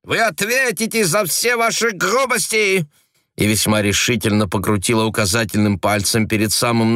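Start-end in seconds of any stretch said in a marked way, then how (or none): 0.0-2.9 very high outraged threat; furious threat. A narrator's reading. Frenchwoman threatening the agent, furious high cry. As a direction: threaten him in fury.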